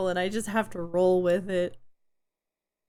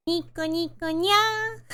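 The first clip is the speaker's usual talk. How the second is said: high, squeaky anime catgirl; cute catgirl nya; 8 semitones higher, slower than usual. Pretend. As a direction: purr it out like a cutesy cat girl.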